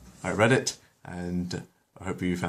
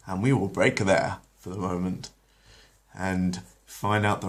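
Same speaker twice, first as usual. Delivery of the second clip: ordinary delivery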